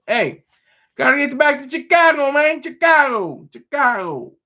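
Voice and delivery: high, loud put-on Chicago accent, stretching the word Chicago; bad Chicago accent. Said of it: "that was a terrible accent".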